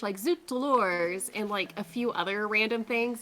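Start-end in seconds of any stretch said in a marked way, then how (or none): 0.2-1.3 slow, lightly put-on French accent on Zut alors; a hint of a bad French penguin accent; broken French. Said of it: "a lot of broken French".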